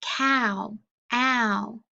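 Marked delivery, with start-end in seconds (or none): 1.1-1.8 "ow" said alone as a gliding ow; isolated sound. Shown as a demonstration. Teacher showing kids the ow sound. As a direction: model the "ow" glide on its own. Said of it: "Diphthong O W".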